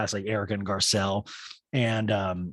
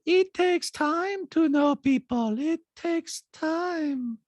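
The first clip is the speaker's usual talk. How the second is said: high, slow, detached intoning; creepy monotone, every word stretched; Diana; much slower than usual. Retold or imitated. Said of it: "this kind of detached voice".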